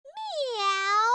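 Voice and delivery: playful little meow like a cat. Acted. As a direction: mew it out like a kitten. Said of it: "I like the way you added meow".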